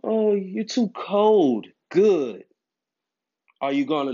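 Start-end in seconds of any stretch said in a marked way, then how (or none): 0.0-1.7 put-on higher, slowed whiny girlfriend voice; mock girlfriend complaint. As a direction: parody her nagging, stretched out.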